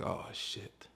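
"Oh, shit." breathed out as a whisper; whispered dread. Retold or imitated. Recruit just summoned to the office, whispered dread. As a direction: whisper it under your breath.

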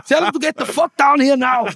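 imitating a high, loud, exasperated shout; hammed-up shouted summons, pitched right up; yelled order. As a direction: yell the demand as the manager would. Acted angry order over the phone.

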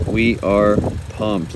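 ordinary talk

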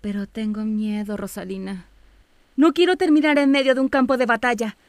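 anxious plea. Acted. Acted frightened plea to sister.

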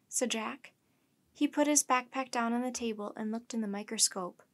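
usual speaking voice

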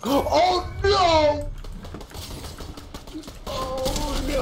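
high, loud cartoon "oh no"; cartoon panic cry. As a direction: yelp it like a scared cartoon dog. Cartoon cry after falling.

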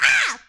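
short, high, loud mock scream; sharp hammed-up cry with a slap; mock shriek. [name] cries out as she slaps.

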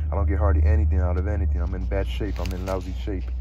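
loud, heated insistence rising to a shout. Acted. Holden yelling his point at Sally in a bar. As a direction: hammer the point home, yelling. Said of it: "Stop screaming at me".

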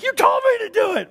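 very high, shouted protest; defensive shout. Retold or imitated. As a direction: yell back in self-defence. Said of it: "he's like".

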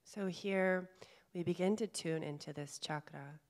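calm meditation guide, long pauses between phrases; guided meditation. Real, not acted. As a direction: gently guide them inward, leaving space to breathe. Leads a guided chakra meditation.

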